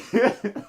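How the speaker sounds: a burst of real laughter. Real, not acted. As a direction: laugh out loud, genuinely. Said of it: "I was laughing".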